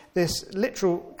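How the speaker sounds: ordinary talk, nothing marked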